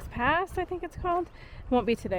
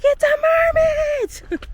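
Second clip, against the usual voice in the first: high, loud, drawn-out thrilled shout; excited scream; 6 dB louder, much slower than usual. Retold or imitated. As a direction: shriek it with pure delight. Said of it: "I literally screamed".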